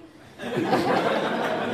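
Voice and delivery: loud unvoiced laughing burst. Real, not acted. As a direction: crack up laughing. Laughs at own question.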